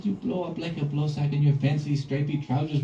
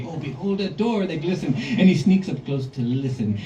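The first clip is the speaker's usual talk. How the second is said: ordinary delivery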